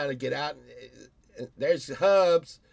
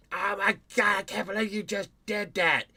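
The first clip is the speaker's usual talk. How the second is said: mock outraged bystander; 7 semitones higher than usual. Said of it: "I'll be like".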